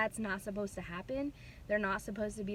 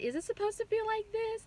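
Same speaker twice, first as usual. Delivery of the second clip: scared; 11 semitones higher than usual. Retold or imitated. Retold fear during numbness.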